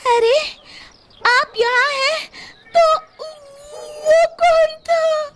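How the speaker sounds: high, startled woman's voice, slowing at the end; shocked wife. A narrator's reading. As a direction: she realises something is wrong. Wife shocked seeing husband. (घबराई हुई पत्नी की ऊँची आवाज़)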